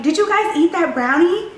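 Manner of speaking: high, loud blurt; loud high question to the table; hella loud. Retold or imitated. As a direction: say it so the whole table hears. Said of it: "Just hella loud".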